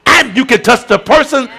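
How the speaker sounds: steady, emphatic sermon teaching; preaching. Acted. Pastor explaining intercession to the church, emphatic. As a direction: hammer home the picture of the gap.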